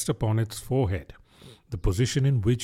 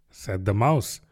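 ordinary delivery

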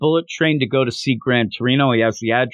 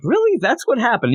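high-pitched incredulous question; acted-out doubting reaction, voice jumping up; mock disbelief; 9 semitones higher than usual.

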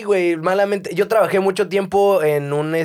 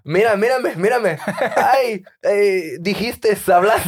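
imitación burlona entre risas; 5 semitones higher, much slower than usual. Retold or imitated.

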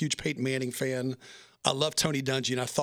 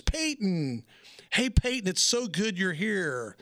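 higher, slow, teasing roast voice; mock Nikki Glazer; 5 semitones higher, much slower than usual. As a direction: play the roaster teasing Peyton slowly. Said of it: "she looks at him, and she goes".

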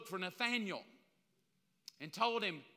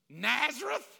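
incredulous; 4 semitones higher, 6 dB louder than usual. Acted. Nathanael scoffing at hometown.

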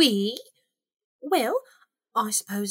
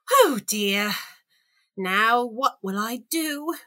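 dismayed; 12 semitones higher, slower than usual. Acted. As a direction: sink into helpless dismay. Alice is left alone, dismayed.

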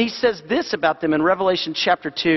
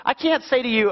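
ordinary talk

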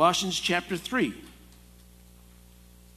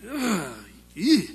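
disgusted groan; 3 semitones higher than usual. Retold or imitated. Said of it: "It's like".

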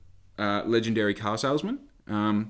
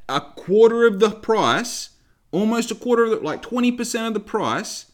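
higher and louder, real disbelieving outrage; outraged rant; 7 semitones higher, 5 dB louder than usual. Real, not acted. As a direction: let the indignation burst out. Ranting at the lowball offer.

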